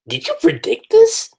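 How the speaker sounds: high-pitched, disbelieving quoted question; incredulous question. Retold or imitated. Quotes own astonished question to Ishida.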